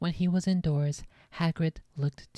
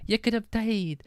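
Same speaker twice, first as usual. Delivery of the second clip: higher sobbing cry; 7 semitones higher than usual. A narrator's reading. Hagrid crying to Harry about his danger, sobbing. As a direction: wail it through tears. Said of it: "sobbed Hagrid".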